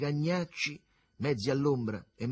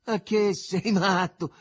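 high, loud, drawn-out question; amused disbelief; 9 semitones higher, 9 dB louder, slower than usual. A narrator's reading. Arduccio reacts to the plan of a fountain bath, amused. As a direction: tease a pal for a crazy idea. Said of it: "fece divertito Arduccio".

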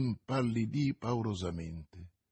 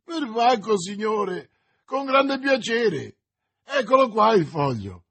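high, loud, eager exclaiming; delighted; 8 semitones higher, 6 dB louder than usual. A narrator's reading. Stableman offered money for the paper, overjoyed. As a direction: jump gladly at the offer. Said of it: "felice dell'occasione che s'era presentata".

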